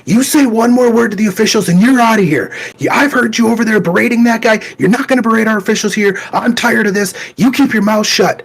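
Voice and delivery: a teen table worker yelling in a parent's face, mock furious; angry yell. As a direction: bark at him like an official losing his temper. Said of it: "is in my face going".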